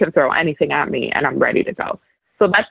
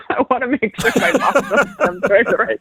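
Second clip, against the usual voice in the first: words bubbling with real laughter, higher pitch; laughing; 7 semitones higher than usual. Real, not acted. Joking with the host about her boss, laughing as she talks. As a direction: laugh through the line.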